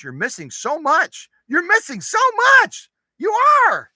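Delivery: high, loud playful telling-off; exaggerated teacherly outburst; mock scolding. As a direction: scold them with a rising, hammed-up shout.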